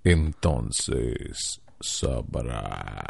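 dragged-out very slow drawl. Shown as a demonstration. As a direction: stretch each word way out. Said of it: "ni cuál robot".

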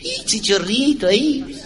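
high, slow, squeaky mocking voice; mock Spaniard. Spaniards at the urinal, squeaky and slow. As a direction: squeak it like a tiny man. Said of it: "decían".

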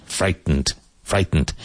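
"frightened" with a barely released T, straight into "nd"; touch the T in "frightened" lightly, no vowel after it. Shown as a demonstration. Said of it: "the T must be pronounced, but very, very lightly".

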